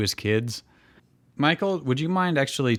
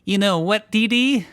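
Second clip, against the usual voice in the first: high cartoon kid voice, pitched well up; cartoon boy Dexter; 10 semitones higher, 4 dB louder than usual. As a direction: squeak it out like a know-it-all boy genius. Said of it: "said Dexter on the T V".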